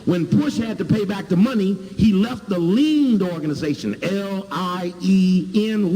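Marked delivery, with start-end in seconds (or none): 4.0-6.0 spelled out slowly, letter by letter. Shown as a demonstration. Speaker spelling the legal term for the audience, slow and deliberate. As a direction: stretch each letter for the audience.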